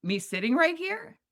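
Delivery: incredulous, rising high; indignant. Retold or imitated. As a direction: pitch shoots way up in disbelief. Indignant retold question.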